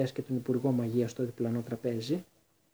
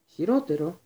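eager, curious, a bit louder; curious question; 6 semitones higher, 5 dB louder than usual. A narrator's reading. Rosmerta pressing the Minister, full of curiosity. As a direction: lean in wanting to know. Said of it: "είπε όλο περιέργεια".

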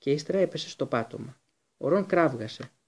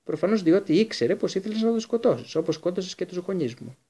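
accusing; 6 semitones higher than usual. Acted. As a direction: hurl the accusation at him.

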